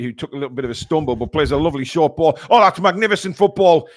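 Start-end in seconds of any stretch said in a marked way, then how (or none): 2.5-3.9 excited. Real, not acted. Praising a slick move.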